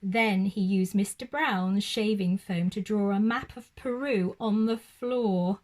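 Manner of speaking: usual speaking voice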